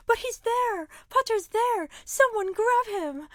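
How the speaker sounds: high, urgent accusing cry; Pansy Parkinson. Acted. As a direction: call out in high-pitched alarm to have someone seized. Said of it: "Pansy Parkinson has a different idea. She stands up and is like".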